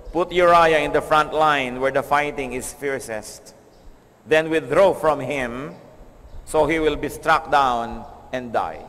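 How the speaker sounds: slow, grave reading of the letter; scripture reading. Acted. Preacher reads David's order to Joab, slow and grave. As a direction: read it slowly, weighing each word.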